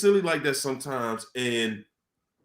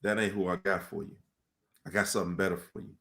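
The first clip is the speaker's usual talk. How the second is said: softer, quick inner voice; 7 semitones lower, 8 dB quieter, much faster than usual. Retold or imitated. The voice inside you speaking, softer and quick. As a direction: murmur it fast, like a voice inside your head. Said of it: "that voice in you that's saying".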